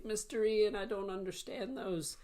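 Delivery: high, lost student voice; confused student. Retold or imitated. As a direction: act the puzzled viewer pleading with the teacher. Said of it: "You say".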